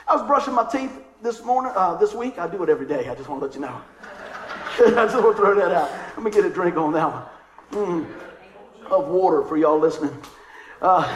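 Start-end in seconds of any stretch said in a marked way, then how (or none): 4.8-5.9 loud laughing aside; amused, words riding on laughter. Real, not acted. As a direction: say it through a real laugh. Laughs at own toothbrushing remark.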